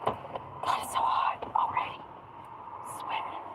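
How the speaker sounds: unvoiced whisper to oneself; whispered complaint. Real, not acted. Streamer grumbling about the heat, whispered. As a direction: whisper it under your breath.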